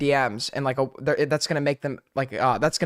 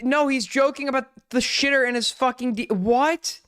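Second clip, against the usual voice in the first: incredulous outburst; 9 semitones higher than usual. Real, not acted. Co-host objecting to a misreading, in incredulous higher voice. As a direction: cut in, baffled by the claim.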